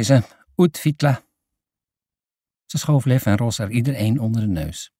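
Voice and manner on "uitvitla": put-on higher UITVITLA in a foreign accent; foreign woman's accent. A narrator's reading. Woman instructs children in her language, foreign accent. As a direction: say UITVITLA as a native, pitched up.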